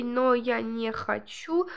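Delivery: ordinary talk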